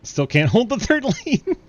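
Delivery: high, loud laughing exclamation; amused shout breaking into laughter; laughing jab. Real, not acted. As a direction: crack up while calling it out. Amused at another wreck.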